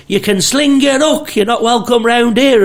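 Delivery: high, mock scolding old woman. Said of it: "This old woman's like".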